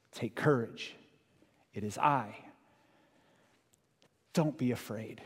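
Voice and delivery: soft, slow reassurance, slightly steadier; calm them again; quiet reassuring repeat; gentle repeat. Acted. Repeated softly.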